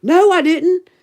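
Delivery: mock-indignant no; retold denial. Mom denying to daughter, insisting in mock indignation. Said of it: "I'm like".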